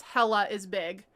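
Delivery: normal talking voice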